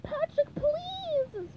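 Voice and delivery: very high begging; desperate high cartoon plea; SpongeBob. A narrator's reading. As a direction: beg in a squeaky wail. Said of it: "SpongeBob begged".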